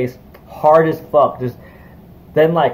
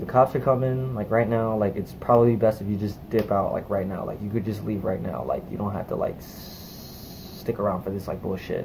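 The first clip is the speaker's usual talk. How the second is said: hushed warning; 4 semitones lower, 9 dB quieter than usual. Retold or imitated. Bystander warns cops are coming.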